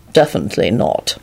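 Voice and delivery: low, stern refusal. A narrator's reading. Lupin refusing to give up his cloak, sternly. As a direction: drop the pitch and refuse firmly. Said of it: "Lupin replied sternly".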